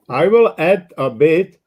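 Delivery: usual speaking voice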